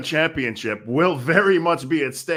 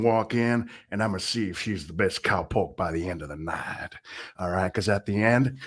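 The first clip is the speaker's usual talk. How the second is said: put-on lower-pitched Western cowboy accent; 5 semitones lower than usual. Commentator hyping the Shotgun Bonanza in a lower Western cowboy accent. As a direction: talk like a Wild West cowpoke in a Western accent. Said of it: "How is this accent?".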